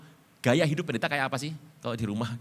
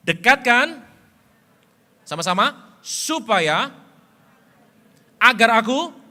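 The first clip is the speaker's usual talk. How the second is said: put-on loud, high old father calling; Isaac's voice; 6 semitones higher, 11 dB louder, slower than usual. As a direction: call the son near, loud and high. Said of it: "Berkatalah Ishak".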